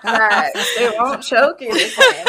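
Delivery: giggling "facts, they won't choke you the same"; laughing agreement. Retold or imitated. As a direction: crack up while agreeing. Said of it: "I was like".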